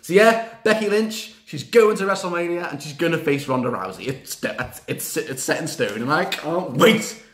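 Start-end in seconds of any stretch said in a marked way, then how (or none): none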